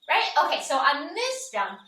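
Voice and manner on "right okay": normal talking voice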